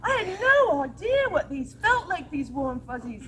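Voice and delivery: transformed witch delighted by warm fuzzies, lightly British. Acted. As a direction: gush in a lightly British accent. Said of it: "soft, lovely British voice".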